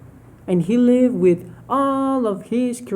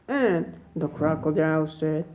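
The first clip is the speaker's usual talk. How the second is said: ordinary delivery, nothing marked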